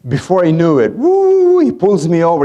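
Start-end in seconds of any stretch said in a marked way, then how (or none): 0.9-1.6 excited high-pitched woo; a sudden loud whoop. Retold or imitated. Preacher telling of being pulled over, high whoop. Cop pulls him over.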